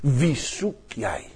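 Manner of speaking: slow, soft question; gentle Jesus. Retold or imitated. Jesus asks Maria whom she seeks, slow and soft. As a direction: ask it tenderly and slowly. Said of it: "Dat zegt hij".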